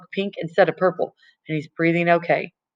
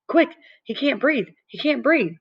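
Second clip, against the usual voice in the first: high, urgent cry for help; panicked call for help; 9 semitones higher than usual. Acted. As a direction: shout it out, frantic, as your friend chokes. Running for nurse, friend choking.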